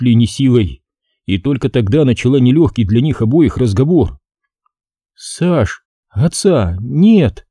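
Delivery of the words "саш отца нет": slow, drawn-out grief; trembling voice. A narrator's reading. Mother telling her son of his father's death, trembling voice. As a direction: break it to him with a slight tremble. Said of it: "дрожащим голосом сообщила Зинаида".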